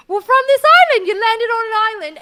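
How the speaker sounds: high, fast, loud outburst; exasperated shout. Acted. Mermaid insisting on the island to a nosy newcomer, exasperated high shout. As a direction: shout it indignantly at the outsider.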